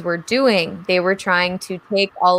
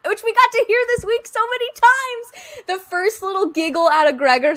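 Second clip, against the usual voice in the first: excited. Real, not acted. Gushing about cute laugh.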